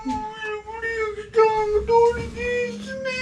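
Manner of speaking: high, wailing whine; whiny crying. Real, not acted. Husband crying high on bhang.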